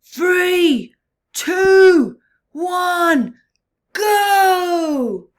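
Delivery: loud, high shouted countdown; ringing hauler's countdown. Acted. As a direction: call it out from the boat. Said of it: "he heard".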